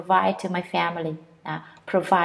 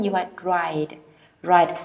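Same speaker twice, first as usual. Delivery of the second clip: ordinary talk, nothing marked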